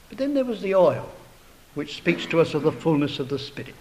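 normal talking voice

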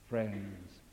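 ordinary talk, nothing marked

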